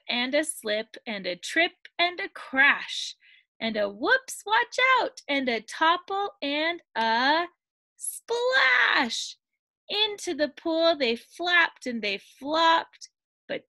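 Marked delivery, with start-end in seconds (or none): 4.5-5.2 high, sudden cry; playful read-aloud alarm; alarmed warning. A narrator's reading. Story action, tumble into pool. 8.0-9.4 thrilled climax word; excited splash. A narrator's reading. Climax of the tumble.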